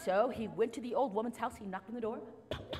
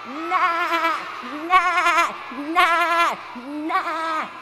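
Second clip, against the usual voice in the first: loud, high goat bleats; goat bleating; 9 semitones higher, 13 dB louder, much slower than usual. Acted. Storyteller shows children the goat's sound, bleating. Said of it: "what sound does the goat make?".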